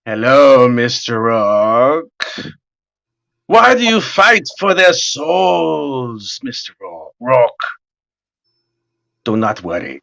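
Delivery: low, slow menacing devil. Retold or imitated. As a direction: drawl it like a sinister TV devil. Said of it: "he's standing there and he's like".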